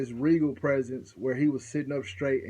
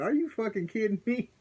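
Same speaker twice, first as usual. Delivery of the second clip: incredulous spirit; 8 semitones higher than usual. Retold or imitated. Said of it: "the spirit's like".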